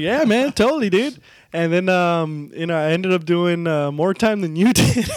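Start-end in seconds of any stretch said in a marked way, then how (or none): 0.0-1.2 high, eager surfer-dude agreement; chirpy bro yes. Retold or imitated. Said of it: "And I was like".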